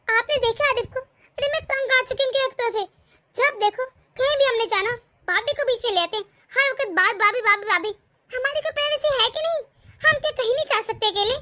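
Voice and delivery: daughter-in-law complaining to mother-in-law, exasperated; fed-up complaint. Acted.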